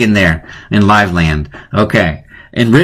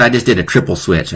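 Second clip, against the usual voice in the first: ordinary talk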